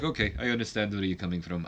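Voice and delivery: a light mock Russian accent. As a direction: voice the wrestler with a hint of Russian. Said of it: "I give him a Russian accent".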